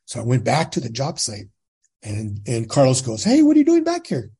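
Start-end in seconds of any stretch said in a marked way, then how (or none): 3.2-4.3 much higher and louder, a startled hail; surprised call out. Retold or imitated. Carlos calling to Jim back at the site, surprised loud hail. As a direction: call across the job site, caught off guard.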